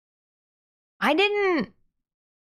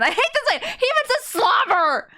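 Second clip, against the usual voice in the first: high disgusted cry; 12 semitones higher than usual. Real, not acted. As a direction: squeal it in revulsion.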